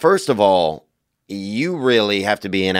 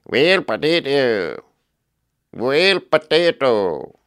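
slow, drawn-out mock Idaho twang; Idaho sound; 4 semitones higher, slower than usual. Shown as a demonstration. Showing listeners a mock Idaho accent, slow. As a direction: stretch "Well, potato" slow like a rural Idaho voice. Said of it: "something from Idaho".

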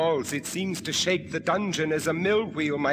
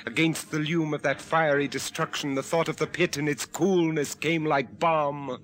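low, grave narrator voice; story narration; 4 semitones lower, 3 dB quieter than usual. A narrator's reading. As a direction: narrate the ordeal darkly. First-person narration.

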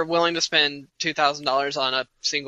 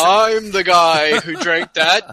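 slow, louder smug boast; mock braggart; 6 dB louder, much slower than usual. Mocking the guy who drank it, slow louder gloating boast. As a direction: puff up and gloat over every word. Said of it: "you wanna be like".

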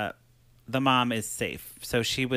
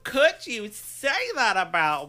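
high, slow, hammed-up indignation; Vanessa Marcel; 9 semitones higher, slower than usual. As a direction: wail it like a wounded soap diva. Acts offended Vanessa on speakerphone.

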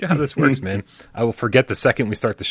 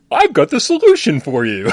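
loud, higher sing-song helper; laughing mock helper; mock cheerful visitor; 5 semitones higher, 5 dB louder than usual. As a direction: play the chipper do-gooder. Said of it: "be like".

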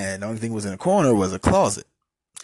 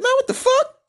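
high, loud retold yell of disbelief; shocked outburst; 7 dB louder than usual. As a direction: blurt it out in shock, much higher. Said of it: "I said".

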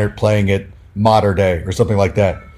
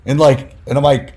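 ordinary delivery

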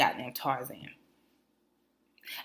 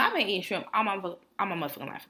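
usual speaking voice

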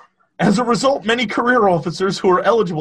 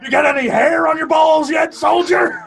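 loud, high parade-ground shout; shouting drill sergeant; 11 semitones higher, 6 dB louder than usual. Acted. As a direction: bellow at a raw recruit. Mocking the film's drill sergeant.

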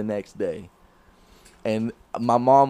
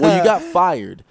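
mom's blunt news; 4 semitones higher, 8 dB louder than usual. Retold or imitated. Mom delivering bad news.

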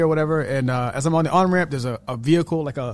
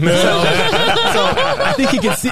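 laughing; 5 dB louder, much slower than usual. Real, not acted. Laughing while telling the story.

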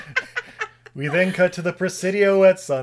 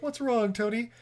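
imitating characters; 7 semitones higher than usual. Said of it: "He's like".

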